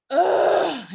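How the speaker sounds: loud, high exasperated groan; high burst of pent-up frustration; venting groan. Retold or imitated. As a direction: let the frustration out in one big "ugh". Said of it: "so I just let myself be like".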